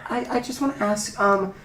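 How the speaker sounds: a hint of a hesitant, anxious mom; mother asking nervously about her son; worried mother.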